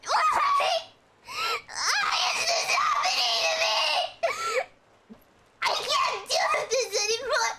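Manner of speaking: high, loud sobbing wail; crying. Acted. Despairing man crying alone with a gun, sobbing loud. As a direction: sob out why it happens to you. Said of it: "He is crying".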